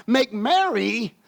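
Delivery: slow, each word pressed out; slow demanding Martha. Acted. Martha to Jesus, slow demanding voice. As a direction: drag out the demand. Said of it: "She says".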